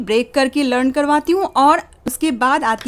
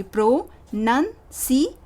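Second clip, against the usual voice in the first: slow demo word; much slower than usual. Shown as a demonstration. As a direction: say pronunciation slowly, syllable by syllable. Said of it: "इस तरह से करवाऊँगी".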